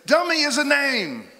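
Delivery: loud, high-pitched scolding yell; scolding grandmother. Retold or imitated. Grandmother to name-calling boys, yelling a scolding. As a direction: shout the correction at full volume. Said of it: "And grandmother says".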